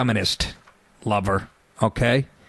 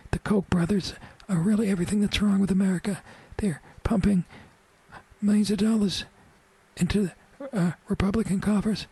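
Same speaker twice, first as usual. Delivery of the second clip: a frail, breathy politician voice, much higher; Harry Reid impression; 9 semitones higher than usual. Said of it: "He talks like this".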